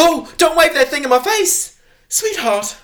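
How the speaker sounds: loud, high, panicked protest; alarmed mother. Acted. Mother to partner waving a gun at her, shrill comic alarm. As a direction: cry out in hammed-up alarm.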